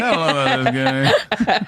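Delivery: high, slow, laughing doubt; mock doubtful spouse. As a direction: drag out the doubt, pitched high. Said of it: "you're like".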